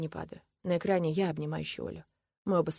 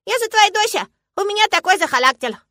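put-on high, loud childish declaration; proud little girl; 13 dB louder than usual. A narrator's reading. Girl claiming father's character, proudly insistent. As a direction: announce it as obvious.

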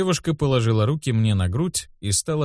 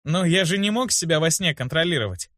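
higher-pitched protest; indignant boy; 6 semitones higher than usual. A narrator's reading. Boy answers the healer's reproach, indignant. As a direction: defend yourself, mildly indignant. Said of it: "возмутился я".

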